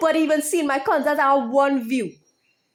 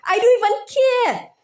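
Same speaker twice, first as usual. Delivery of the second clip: exasperated outburst; 7 semitones higher than usual. Real, not acted. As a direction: throw it out in real exasperation. Escalating rant, repeated line.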